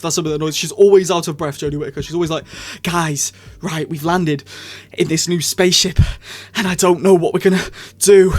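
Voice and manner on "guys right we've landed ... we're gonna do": mock breathless Doctor. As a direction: ham up a breathless Jodie Whittaker. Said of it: "Why is she always out of breath?".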